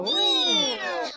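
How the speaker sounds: very high squeaky meow; silly clown meow. Pretend. Silly plane noise joke.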